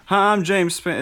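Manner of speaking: high, loud weatherman voice; a loosely imitated TV weatherman; James Spann impression. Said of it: "I can't get his voice".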